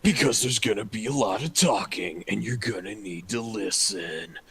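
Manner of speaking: lightly done movie trailer guy. Pretend. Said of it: "movie trailer guy voices".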